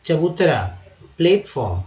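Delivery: usual speaking voice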